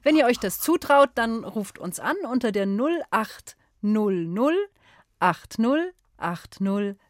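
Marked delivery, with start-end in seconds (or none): 2.7-7.0 slow number read. Shown as a demonstration. Host gives phone number slowly.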